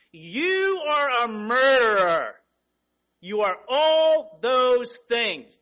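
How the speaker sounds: from slow and measured to a loud accusing preach; rising fire and brimstone; building preacher. Acted.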